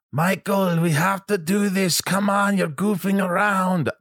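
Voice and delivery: high, slow French-ish director's scolding; vague French accent. Retold or imitated. Jeannot telling Michael to stop goofing, mock French. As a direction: imitate Jeannot nudging you. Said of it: "I don't even know what accent I'm doing".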